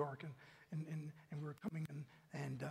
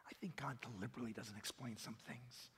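whispered aside; 7 semitones lower, 10 dB quieter than usual. Real, not acted. Intimate sermon aside.

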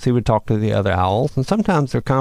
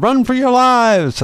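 high, loud and drawn-out cry; frantic yell; 13 semitones higher, 5 dB louder, slower than usual. Acted. Coyote fleeing the possum attack, slow loud panicked yell. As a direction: scream at the others to flee.

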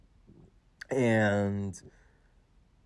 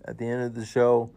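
ordinary talk, nothing marked

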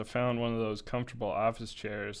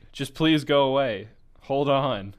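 loud plea; 4 semitones higher, 10 dB louder than usual. Acted. Girl shouting at narrator.

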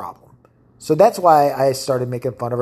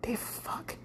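whispered "They fuck." with no voice; sneaky realization, whispered; 8 semitones higher, 19 dB quieter than usual. Retold or imitated. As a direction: breathe "They fuck." as a shocked secret. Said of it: "and you're like".

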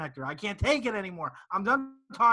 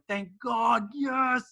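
relieved cheer; 4 semitones higher, much slower than usual. Retold or imitated. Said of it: "I'm like".

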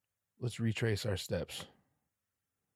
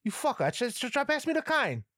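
put-on much higher, louder old grandma scolding; 14 semitones higher, 4 dB louder than usual. Grandma yelling at her grandson to pass it. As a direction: play the cranky old grandma cussing him out. Grandma voiced, cursing at him.

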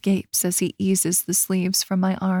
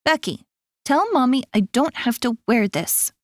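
put-on higher child voice, complaining; a small boy begging to skip the bow tie; whiny little boy; 5 semitones higher than usual. A narrator's reading. Little boy complaining about tuxedo.

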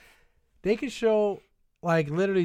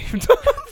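high laugh; 8 dB louder than usual. Real, not acted.

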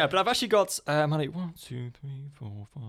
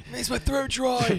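put-on old man; 9 semitones higher, slower than usual.

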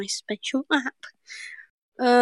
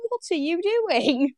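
higher, stretched question rising at the end; baffled; 6 semitones higher, slower than usual. Retold or imitated. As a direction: act out helpless disbelief. Retold baffled repeat of question.

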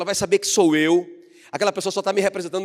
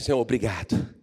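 lower, calm, thankful Senhor, obrigado; grateful prayer; 7 semitones lower, 3 dB quieter than usual. Shown as a demonstration. Believer thanks God, calm lower gratitude. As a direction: settle down and simply give thanks. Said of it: "nossa oração tem que ser".

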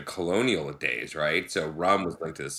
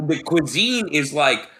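normal talking voice

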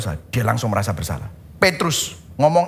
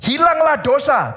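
raised, slow decree "Hilanglah dosa"; mock divine command; proclaimed command; 6 semitones higher than usual. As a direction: declare it like God speaking. Imagined command.

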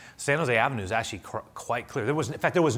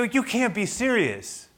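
much higher and slow disbelief; incredulous; 11 semitones higher, 3 dB louder, slower than usual. Retold or imitated. Pastor disbelieving the officer, high and slow. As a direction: stretch out the disbelief. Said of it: "I go".

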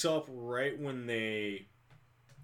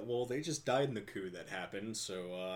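ordinary delivery

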